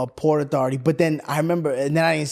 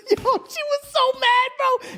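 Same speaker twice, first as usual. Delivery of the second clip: laughing; slower than usual. Real, not acted. Laughing remembering mom's anger.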